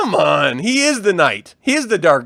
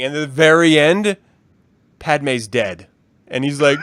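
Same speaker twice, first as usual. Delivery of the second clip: normal talking voice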